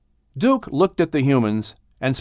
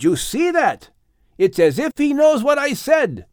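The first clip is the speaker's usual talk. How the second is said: amazed; 13 semitones higher than usual. A narrator's reading. Noah amazed.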